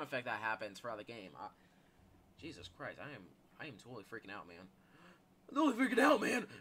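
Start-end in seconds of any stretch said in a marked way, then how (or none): 2.4-4.7 low, soft, quick admission; understated freak-out. Pretend. Says it low, then repeats high. 5.5-6.5 loud, high put-on panic; hammed-up panicky outburst; mock freak-out. Repeats the line in loud mock panic.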